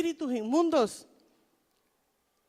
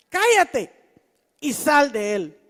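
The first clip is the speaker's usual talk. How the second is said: stern rebuke; 8 dB louder, slower than usual. Acted. Jesus rebukes the spirit.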